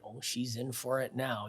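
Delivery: low, soft mutter under the breath. Retold or imitated. Partner's aside as heckler comes up on stage, muttered. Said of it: "I think Steffi muttered".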